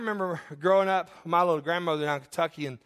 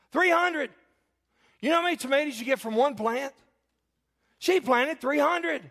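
amazed exclamation; 7 semitones higher than usual. Real, not acted. Marvelling at grandmother's tomato count.

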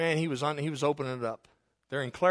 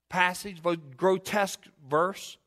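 ordinary talk, nothing marked

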